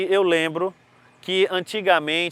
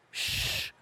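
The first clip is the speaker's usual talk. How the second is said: hushing shh; 11 dB quieter than usual. Acted. Teacher hushing class.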